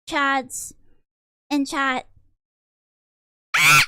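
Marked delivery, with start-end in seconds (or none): 3.6-3.8 banshee scream. Pretend. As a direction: shriek like a banshee. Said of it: "That's my banshee scream".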